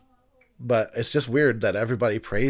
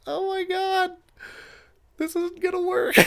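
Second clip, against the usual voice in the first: mock despair. Despairing at long movie.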